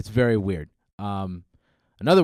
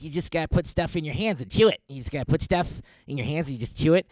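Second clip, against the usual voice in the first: pitched-up, frantic little animal; put-on squirrel character, higher than usual; 5 semitones higher, faster than usual. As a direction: jabber advice like a jittery squirrel. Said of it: "a squirrel is an emotional support animal".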